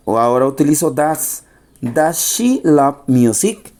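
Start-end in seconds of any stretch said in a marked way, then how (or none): 1.8-3.7 slow, carefully spaced question for learners; slow model question. Shown as a demonstration. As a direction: ask it slowly so students follow. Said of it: "para la pregunta".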